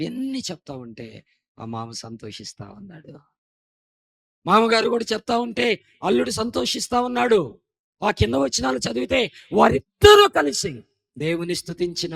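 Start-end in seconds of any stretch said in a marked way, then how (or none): 4.5-12.1 loud, higher fervent preaching. Acted. Preacher praising families to congregation, loud fervent preaching. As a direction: proclaim it to the whole church.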